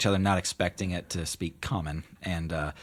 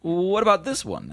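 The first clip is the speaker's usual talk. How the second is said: genie voice; 13 semitones higher, 7 dB louder than usual. Acted.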